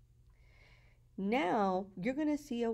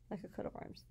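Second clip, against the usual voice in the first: whispered repeat; 10 dB quieter, faster than usual. Shown as a demonstration. Teacher whispering the example phrase to students. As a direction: whisper it the second time, unvoiced.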